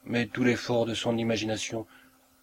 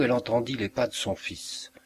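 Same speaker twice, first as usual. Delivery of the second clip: plain reading voice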